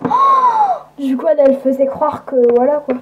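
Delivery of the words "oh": oh suraigu, sidéré; exclamation de stupéfaction; oh surpris. Acted. (oh très aigu d'étonnement)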